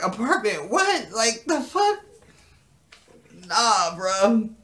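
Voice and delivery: high, loud mock disbelief; incredulous. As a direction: balk at it, pitch leaping up. Acting Jeffrey's disbelief at apartment.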